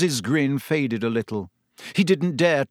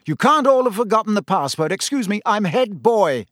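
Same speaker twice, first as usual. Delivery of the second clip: higher, louder, bustling officiousness; Percy, self-important; 7 semitones higher, 4 dB louder than usual. A narrator's reading. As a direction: push through the crowd with pompous authority. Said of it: "he came bustling importantly through the crowd".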